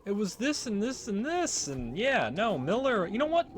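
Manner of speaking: reluctant Naomi. Retold or imitated. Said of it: "She's like".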